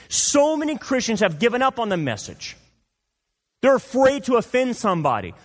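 impassioned sermon climax; fiery preaching. Acted. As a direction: drive the point home to the flock. Preaching at full force.

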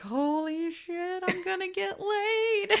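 high, slow, rising mock elation; thrilled. Voicing his big grin lighting up, thrilled. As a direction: stretch it out in hammed-up glee. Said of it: "it lights up like".

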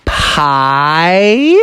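very slow, drawn-out eager question; eager Froggy. Acted. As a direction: stretch every word, rising on the last. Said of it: "And Froggy was like".

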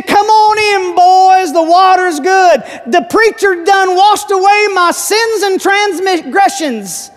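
high, loud, jubilant country convert; Delmar. Retold or imitated. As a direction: holler it out with drawn-out joy. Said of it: "I think it was Delmar ... He said".

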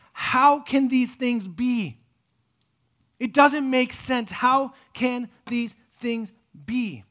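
slow, loud, drawn-out disbelief; the expert crying out, baffled, slowly; loud bewildered question. Retold or imitated.

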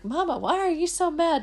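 put-on high, innocent little girl's question; small daughter. Little daughter asking her mother. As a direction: ask sweetly as her young daughter.

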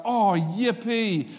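sarcastic cheerfulness, playing the thrilled parent; mock enthusiasm. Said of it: "None of us enthusiastically go".

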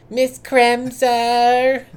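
"Miss Kremser" drawn out very slow and high; Luann voice. Retold or imitated. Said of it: "trying to say it like Luann".

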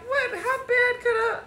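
high, incredulous mock disbelief; surprised. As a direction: sound shocked at the weight. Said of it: "you're like".

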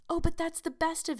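put-on high-pitched girlish protest. A narrator's reading. Anne defends her impulsiveness to Missus Lynde, protesting. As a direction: object earnestly, voice lifted high. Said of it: "protested Anne".

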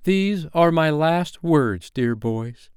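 put-on high, slow, solemn girl's voice; firm. Wendy saying farewell to the boys, firm and high. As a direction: declare it firmly and grandly. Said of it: "she said firmly".

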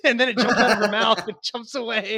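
loud, high, laughing storyteller. Real, not acted. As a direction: tell it while cracking up. Cracking up telling dog story.

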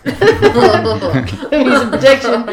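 laughing through the words. Retold or imitated. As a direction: giggle while saying it. Mocking the security guard.

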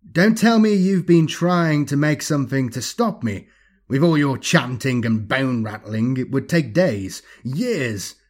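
mocking, theatrical taunt; gloating alien villain. Alien thing mocking the men's efforts, gloating villain. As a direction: start high and loud, easing as the scorn unrolls.

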